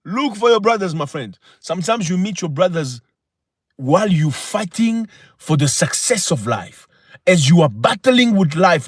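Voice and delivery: emphatic motivational oratory, rising high on key phrases; impassioned preaching. Real, not acted. Host exhorting viewers about true brothers, impassioned preaching. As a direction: preach it to the crowd, punching each point.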